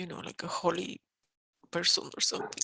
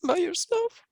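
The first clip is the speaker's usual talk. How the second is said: higher, louder, rising protest; distressed outburst; 4 semitones higher, 10 dB louder than usual. Real, not acted. Client protesting being locked up, rising higher and louder. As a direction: push the words out in distress. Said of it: "emotional outburst".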